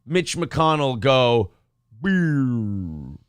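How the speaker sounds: slow, low power-down sound. Retold or imitated. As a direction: let the words wind down like a dying computer. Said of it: "the old school eighties power down noise".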